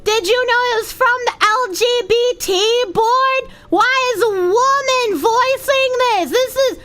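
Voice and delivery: high whiny outraged commenter voice; shrill mock outrage, rising to a yell; mock angry commenter. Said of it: "People being like".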